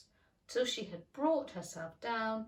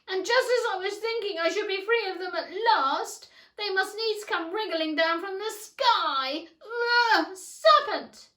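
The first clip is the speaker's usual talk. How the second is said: indignant pigeon; 11 semitones higher, 12 dB louder than usual. A narrator's reading. Pigeon outraged at Alice, a high loud cry. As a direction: let the outrage burst out.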